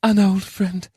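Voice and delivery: high, wistful character voice; a touch of wistfulness, high-pitched. A narrator's reading. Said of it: "Charon replied wistfully".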